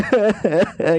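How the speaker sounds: short run of put-on giggles; acted laugh. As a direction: laugh off his threat. Girl laughs at hero's threat.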